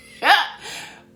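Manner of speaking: sudden high, loud laugh; burst of laughter. Real, not acted. Laughing at her denial to mom, loud burst of laughter. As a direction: let a real laugh break out.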